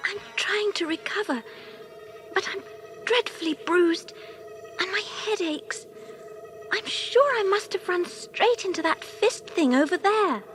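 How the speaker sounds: soft, high-pitched and bruised; low, weak voice. A narrator's reading. Kirill, lying bruised, tells William what happened, weakly. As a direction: speak softly, still recovering from a knock. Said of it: "said in a low voice".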